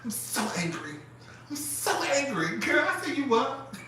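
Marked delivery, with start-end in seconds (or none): 0.0-2.6 slow, higher mock-angry voice. 3.2-3.8 higher, incredulous retort; baffled disbelief. Retold or imitated. Speaker replying to her angry friend, baffled.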